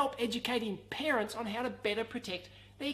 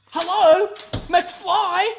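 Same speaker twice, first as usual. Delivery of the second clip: silly mismatched redub; 6 semitones higher, 14 dB louder, much slower than usual. Pretend. Back to the Future quote, knocking.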